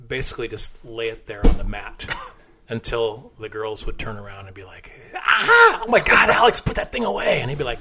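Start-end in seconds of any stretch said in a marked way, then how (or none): 5.1-7.4 high, loud imitation of scandalized women; mock shocked shriek. Bar staff scolding the exhibitionist friend, mock shock. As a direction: act out a startled scream, then scold.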